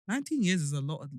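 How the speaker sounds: ordinary talk, nothing marked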